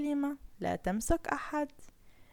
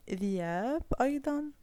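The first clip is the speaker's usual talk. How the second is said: ordinary delivery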